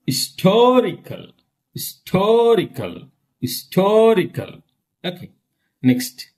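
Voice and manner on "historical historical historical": "Historical" with TO punched and stretched; stress on TO. Shown as a demonstration. Teacher drilling third syllable from end, strong stress. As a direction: hit the second syllable of "Historical". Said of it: "Third syllable from them".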